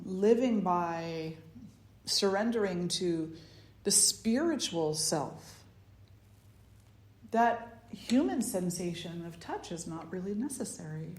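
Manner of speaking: ordinary delivery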